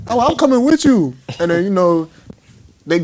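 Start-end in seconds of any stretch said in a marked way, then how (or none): 0.1-1.1 eager. Retold or imitated. Eager to tag along.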